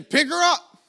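loud, high yell of command; shouted order. Retold or imitated. Healer ordering helpers to lift the fallen lady, shouting. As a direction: bellow it at the helpers. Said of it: "He says it again".

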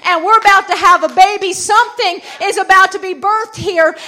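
loud, emphatic revival sermon; full-force preaching. Real, not acted. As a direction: drive each phrase home to the congregation.

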